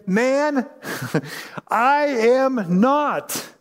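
high, very slow, with a nervous laugh; drawn-out denial. Acted. Peter denying to a bystander, slow high nervous laugh. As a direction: stretch it out, half laughing it off.

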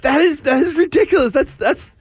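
very high, squeaky disbelief; incredulous. Real, not acted. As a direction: pitch it way up, stumbling in amazement. Marvels at the odd voice clip.

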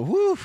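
put-on big high whistling whew at the old photo; embarrassed high-pitched whew of relief. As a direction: let out a loud, cringing "whew". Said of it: "and I go".

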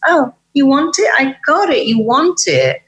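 high, eager voice of the mind; the mind gladly answers its owner. Retold or imitated. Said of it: "the mind goes".

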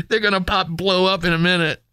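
high, giggling gloat; gleeful toy soldier. Retold or imitated. Kid voicing good guys gloating about the trap, high and giggly. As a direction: gloat over the trap with a laugh.

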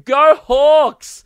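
high, loud team yell; scream. Retold or imitated. Screaming among Tigers fans, high loud yell. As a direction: scream it out like a fan. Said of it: "and I scream".

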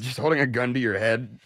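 ordinary delivery

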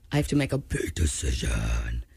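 very low mock trailer voice; deep dramatic voice. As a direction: intone it like a movie trailer.

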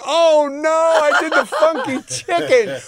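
high dismayed yelp, slowing on funky chicken; dismayed cry. Retold or imitated. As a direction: replay the horror of being caught on film. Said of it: "I went".

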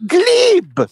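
high, drawn-out single word; mock pronouncement of the word Glib; mimicking him. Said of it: "he goes".

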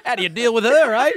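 hammed-up matey Aussie; mock blokey agent. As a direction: josh like a matey call-centre bloke.

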